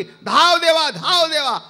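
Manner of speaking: loud pleading call, high; desperate cry for help. Retold or imitated. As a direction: cry out to God in distress. Draupadi crying out to God. (देवाला आर्त हाक)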